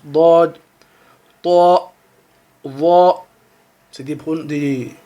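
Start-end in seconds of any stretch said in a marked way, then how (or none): 0.0-3.1 Dad, ta, va dits graves, bouche gonflée, plus fort; lettres emphatiques. Shown as a demonstration. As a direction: gonfler la bouche sur chaque syllabe. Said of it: "tu gonfles la bouche quand tu le prononces". (emphatiques, bouche pleine)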